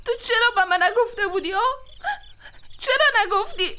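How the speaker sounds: crying while accusing her husband; tearful reproach. Acted. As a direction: choke out the question through tears. Wife confronts husband, crying. (پرسش گریان از شوهر)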